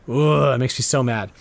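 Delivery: normal talking voice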